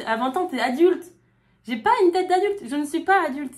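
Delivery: high, loud comic outrage; mock panicked protest. Refuse en riant d'être adulte. (protestation paniquée, aiguë et forte, pour rire)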